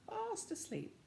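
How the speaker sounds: usual speaking voice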